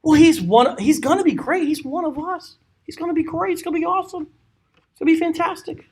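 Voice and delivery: mock fans cheering their guy; excited crowd. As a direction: sell it like eager supporters. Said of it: "For they said".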